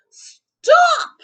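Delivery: put-on shrill very loud child scream; very high, very loud shout; loud yell. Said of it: "Taya yelled very loudly".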